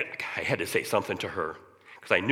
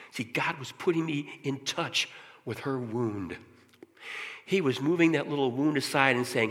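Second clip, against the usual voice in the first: usual speaking voice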